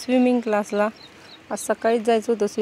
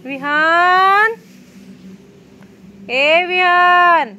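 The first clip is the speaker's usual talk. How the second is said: long drawn-out call, higher and louder; 8 semitones higher, 5 dB louder, much slower than usual. Real, not acted. Mother calls her son from far away, long loud call. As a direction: call his name slowly across the yard.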